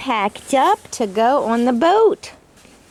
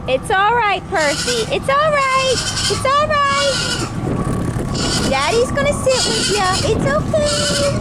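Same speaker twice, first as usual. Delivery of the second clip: soothing pet talk; 11 semitones higher than usual. Real, not acted. Soothing the chicken on the boat.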